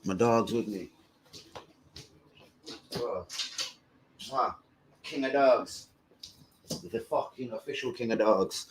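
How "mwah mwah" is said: soft kisses to the dog; kiss sounds. Real, not acted. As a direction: blow a quiet kiss. Kissing his dog.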